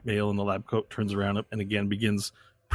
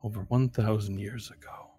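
hushed principal; 9 dB quieter than usual. Acted. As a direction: speak softly, almost under the breath. Principal speaks in story.

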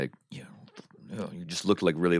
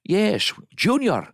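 high, emotional mock Scottish accent; Scottish father overcome at finding his son; Sean Connery; 12 semitones higher, slower than usual. Film father greeting Junior, hammed-up Scottish relief. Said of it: "Do you do a Connery?".

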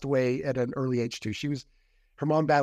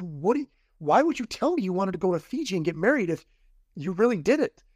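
raised, pushing disbelief; exasperated; 6 semitones higher than usual. Retold or imitated. Retelling confronting her about Fiji, exasperated. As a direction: demand an answer, voice climbing.

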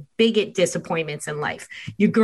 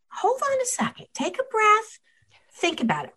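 calming parent; 13 semitones higher, 5 dB quieter than usual. Retold or imitated. Parent calming crying child.

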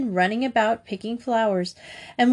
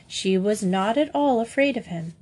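usual speaking voice

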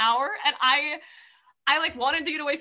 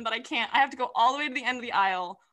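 normal talking voice

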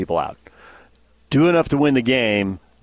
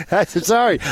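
sorry through laughter, high pitched; laughing apology; 7 semitones higher than usual. Real, not acted. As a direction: apologise while still laughing. Laughing after Superman walks by.

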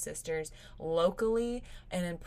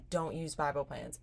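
ordinary talk

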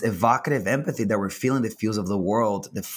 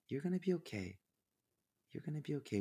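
hushed, tender reassurance; very soft, soothing aside; 4 semitones higher, 14 dB quieter, much faster than usual. Real, not acted. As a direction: drop right down in volume to comfort the listener. Reassuring the listeners softly.

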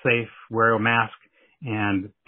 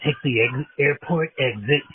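hyped-up local commercial announcer; radio ad read; slower than usual. Acted. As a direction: sell it like a late-night radio spot. Mock strip club radio ad.

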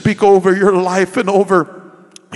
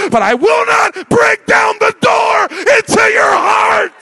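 preaching shout; 5 dB louder than usual. Acted. Preacher climax on God's voice.

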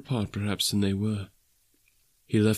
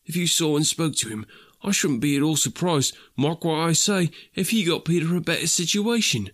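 higher, earnest storytelling in character; lightly voiced Bob, grateful father; 7 semitones higher than usual. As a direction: recount a kindness with gentle enthusiasm.

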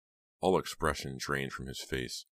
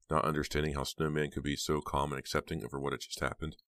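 ordinary delivery

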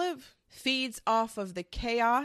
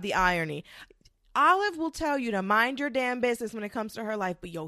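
ordinary delivery

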